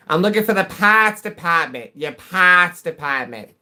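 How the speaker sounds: put-on loud, high New England accent, "parts" as pahts. Caller correcting himself for the locals, hammed-up New England accent. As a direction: drop every R in an exaggerated New England accent. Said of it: "I don't know what it is about New England".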